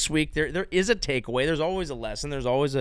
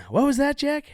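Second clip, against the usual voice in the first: high-pitched questioning put-on voice; hammed-up high query; 8 semitones higher than usual. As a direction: pipe up high, playing a puzzled aide. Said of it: "walking around going".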